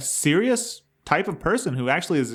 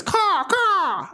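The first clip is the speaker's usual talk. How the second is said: bird caw. Retold or imitated. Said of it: "at least it's".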